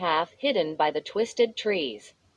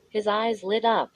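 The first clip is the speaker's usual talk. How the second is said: ordinary delivery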